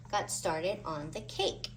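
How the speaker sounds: plain reading voice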